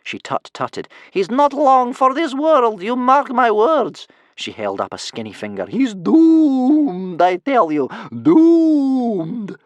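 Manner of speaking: high, slow, loud croaky prophecy of doom; gloomy old crone. Acted. Bear Cub's grandmother foretells Hiccup's doom, slow high crone voice.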